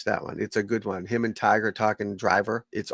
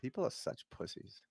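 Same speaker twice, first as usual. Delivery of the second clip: muttering; 2 semitones higher, 19 dB quieter than usual. Real, not acted. Muttered aside.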